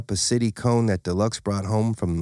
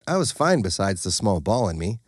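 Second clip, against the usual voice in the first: plain reading voice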